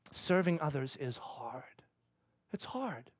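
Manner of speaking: very soft, slow, near whisper; hushed, weary realization; hushed reflection. Retold or imitated. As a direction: think it aloud quietly. Lying there thinking, reflective.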